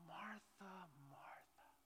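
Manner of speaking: soft whispered name; tender whisper. Retold or imitated. Jesus gently addressing anxious Martha in a tender whisper. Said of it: "Jesus simply says".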